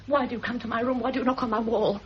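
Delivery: ordinary delivery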